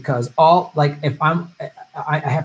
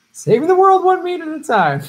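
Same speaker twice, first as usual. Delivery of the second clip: mock slogan; 6 dB louder than usual.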